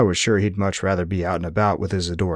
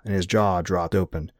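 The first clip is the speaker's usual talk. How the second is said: ordinary delivery, nothing marked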